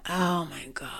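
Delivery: soft, drained exclamation; exasperated aside. Real, not acted. Speaker reacting to an excuse they hate, quietly exasperated. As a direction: drop the volume, fed up.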